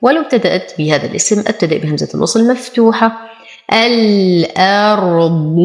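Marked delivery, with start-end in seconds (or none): 3.7-5.6 الأرض slow, lingering on a heavy ر; held heavy rāʾ. Shown as a demonstration. Teacher showing tafkhim, slow heavy ر in الأرض. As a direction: hold the ر and thicken the ض in الأرض. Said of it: "أصبر على الراء مع التفخيم".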